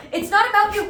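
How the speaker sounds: high, loud telling-off; playful scolding. Real, not acted. Telling the other dog to stop butting in, playfully scolding.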